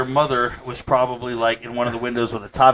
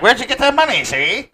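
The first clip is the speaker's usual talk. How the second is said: much higher, louder mock gangster voice; 1930s gangster mom; 12 semitones higher, 4 dB louder than usual. Mom grilling her son about cash, mock gangster voice. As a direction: ham it up like a thirties mobster mother. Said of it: "And your mama was like".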